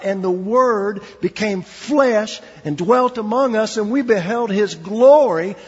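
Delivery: a preacher building toward glory, proclaiming; preaching at full force. Acted. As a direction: swell the verse to a peak of glory. Quoting scripture at full force.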